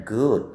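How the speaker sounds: good with a long drawn-out oo; long vowel demo. Shown as a demonstration. Teacher to students, modelling good with a long oo. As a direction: stretch the oo in good. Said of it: "Kalau O-nya double, itu bacanya panjang".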